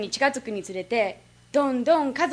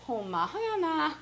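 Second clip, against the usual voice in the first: high-pitched mock scoffing in Kansai dialect; host father watching comedy; 4 semitones higher, 4 dB quieter than usual. Host father commenting on a comedy show, mock scoffing. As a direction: imitate dad jeering at the TV. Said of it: "お笑い番組を見ていた時".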